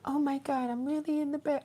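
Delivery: put-on tiny, high, very soft child voice; hushed, high-pitched little girl; really little girl. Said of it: "talking like a really little girl".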